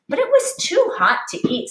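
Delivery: ordinary delivery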